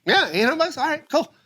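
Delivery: high, offhand put-on voice; Paul Ogata. Shop owner agreeing to sponsor the show, high and casual. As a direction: toss it out high and easy. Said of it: "He goes".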